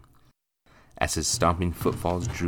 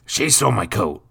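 louder accusing demand; demanding accusation; 5 semitones higher, 8 dB louder than usual. Acted. Drunk master accusing the maid, louder. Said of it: "he demanded of Kathy".

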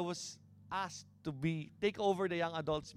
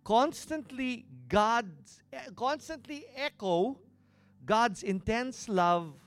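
loud, rising preacher reading of scripture; preaching scripture; 5 semitones higher, 8 dB louder than usual. Acted. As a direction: proclaim the verse with growing force.

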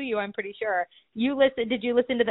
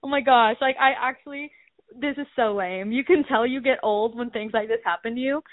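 ordinary talk

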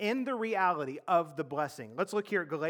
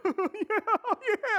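mock polite laugh; 4 dB louder than usual. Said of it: "everyone's like".

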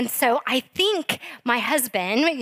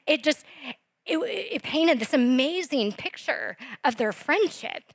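usual speaking voice

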